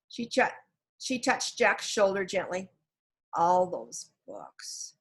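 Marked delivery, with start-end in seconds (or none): 3.3-4.9 very slow, fading sadly; trailing off. A narrator's reading. Jack mourning the books, trailing off. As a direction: let the words die away. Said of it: "His voice trailed off".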